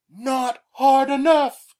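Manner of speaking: high, slow, loud mock grumble; bad Pete impression. Pete blaming his friend for a weak warning, a slow, high, loud mock grumble. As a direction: drag it out as an aggrieved friend. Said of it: "my bad Pete impersonation".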